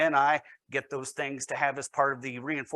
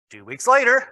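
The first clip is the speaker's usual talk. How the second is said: hammed-up hillbilly take on the SpongeBob narrator, higher and louder; 6 semitones higher, 6 dB louder than usual. Teacher mimicking the SpongeBob narrator in a hillbilly accent. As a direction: announce the time jump like a cartoon narrator, in a put-on hillbilly accent. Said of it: "I was gonna try that with a French accent, but all I can really pull off is hillbilly".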